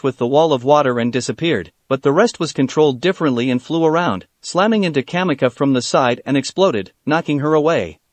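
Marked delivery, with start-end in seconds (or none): none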